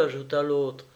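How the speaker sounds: ordinary delivery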